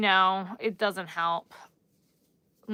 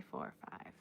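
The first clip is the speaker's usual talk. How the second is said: counting under breath; 18 dB quieter than usual. Real, not acted.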